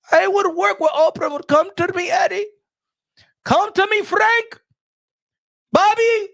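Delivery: high, loud hammed-up hype voice; mock Turkey calling promoters. Turkey Al Sheikh inviting promoters to work with him, high and loud. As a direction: call each promoter over grandly.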